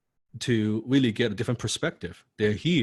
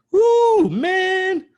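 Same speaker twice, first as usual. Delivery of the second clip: much higher and loud celebratory whoop; cheering; 9 dB louder than usual. Pretend. Celebrating a big win.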